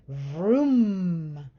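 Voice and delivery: a man vrooming like a fast car in his sleep; loud engine vroom, dreaming of driving; dreamy car noise; sleepy car vroom. A narrator's reading. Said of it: "said Mister Watson in his sleep".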